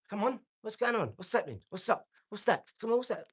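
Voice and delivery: demonstrating aggressive ring talk; confrontational fight talk. Shown as a demonstration. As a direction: goad an opponent in the ring. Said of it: "I talk like this".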